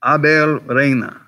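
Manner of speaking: Spanish-accented name, stretched out slowly; Spanish pronunciation. Shown as a demonstration. Host naming the DA for viewers, Spanish accent. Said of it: "if you say it with an American accent".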